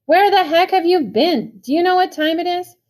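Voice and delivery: high, loud furious demand; angry big brother. A narrator's reading. Older brother scolding the runaway kid, furious. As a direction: tear into the kid for coming home late. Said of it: "He was madder than I'd seen him".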